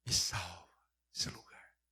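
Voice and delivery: slow, breathy whispered prayer; whispered plea. Retold or imitated. Doctor begging God in the night, slow whisper. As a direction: whisper it like a desperate confession.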